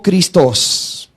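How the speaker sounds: Christos with the last syllable stressed and held; stress on final O. Shown as a demonstration. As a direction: lean on the O of Christos. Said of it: "con tilde, acento en la O".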